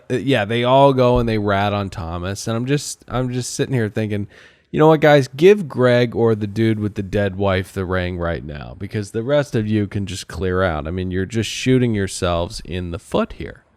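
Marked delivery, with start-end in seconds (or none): none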